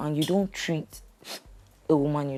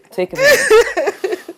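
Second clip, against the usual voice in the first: high drawn-out soothing; 9 dB louder, slower than usual. Acted. Counselor soothing a crying wife.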